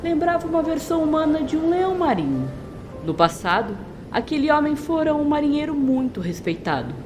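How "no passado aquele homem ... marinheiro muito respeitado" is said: usual speaking voice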